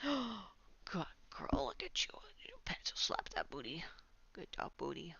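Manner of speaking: soft whispered praise. Real, not acted. Streamer praising her cat, soft whisper.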